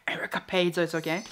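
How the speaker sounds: whisper. Real, not acted. Whispered aside about Erica.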